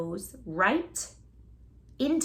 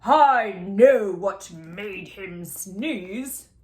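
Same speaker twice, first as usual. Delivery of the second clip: very slow, loud, know-it-all doctor; 6 dB louder, much slower than usual. A narrator's reading. Doctor sure roses caused the sneeze, slow and smug. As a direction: drag every word out smugly. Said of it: "said the doctor".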